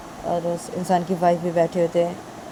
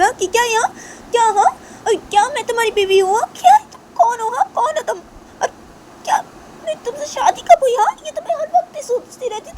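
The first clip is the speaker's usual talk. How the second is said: very high, loud, indignant tirade; outraged Shanaya; 5 dB louder than usual. Acted. Shanaya denying she ever married Tisha, outraged and high-pitched. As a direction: fire off challenges at the top of the voice.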